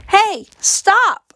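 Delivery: put-on girl screaming as she's lifted to the window. A narrator's reading. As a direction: scream at them to stop. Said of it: "I screamed".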